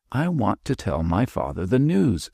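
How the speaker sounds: ordinary delivery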